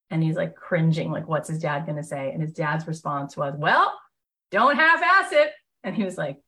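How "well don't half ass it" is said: louder, higher mock blunt dad; a hint of a gruff Texan dad laying down the law; tough Texan dad. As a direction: bark it like a no-nonsense father. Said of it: "tough, like, Texan dad".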